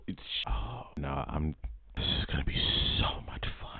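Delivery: hushed unvoiced aside; gleeful whisper. Real, not acted. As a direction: whisper it off-mic.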